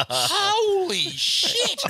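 high, slow drawn-out holy shit; amazed and drawn out; stunned exclamation. Real, not acted. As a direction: stretch it out in shock. Reacting to wild story.

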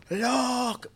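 sudden high yelled look; high shout of look. Retold or imitated. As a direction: call out in alarm. Sister on ropes shouting.

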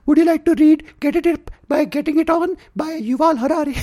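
high, loud cheesy book pitch; mocking book ad voice. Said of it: "after your dick doesn't set up".

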